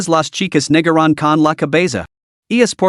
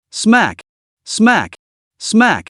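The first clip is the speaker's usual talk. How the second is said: each smack popped out high; higher voiced smack, smack, smack; sharp slap sounds; 6 semitones higher, much slower than usual. A narrator's reading. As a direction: snap out every slap like a hit. Narrator voices slaps landing.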